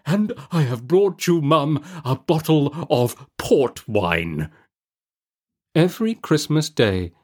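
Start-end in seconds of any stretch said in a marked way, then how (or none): 0.1-4.5 high, slow pompous uncle voice. A narrator's reading. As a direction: puff up like a self-important uncle bringing gifts. Pumblechook voiced in story.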